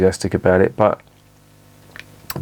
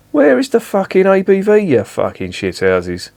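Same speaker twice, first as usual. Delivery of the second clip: exasperated outburst; 10 semitones higher than usual. Real, not acted. Reviewer ranting at the brewery over missing alcohol strength, exasperated.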